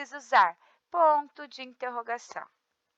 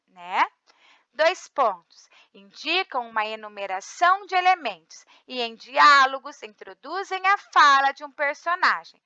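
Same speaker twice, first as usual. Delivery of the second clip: ordinary talk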